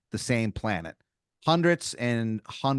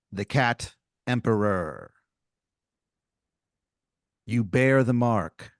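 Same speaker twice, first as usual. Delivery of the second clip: solemn prophecy; slower than usual. Acted. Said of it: "Throggin goes".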